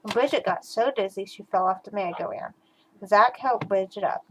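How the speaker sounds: usual speaking voice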